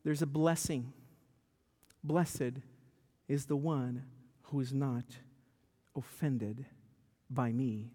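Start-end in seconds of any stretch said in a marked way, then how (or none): 2.0-7.9 solemn scripture. Acted. Pastor reciting Jesus' words to the congregation, reverent. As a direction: lower and softer, slow reverent phrasing.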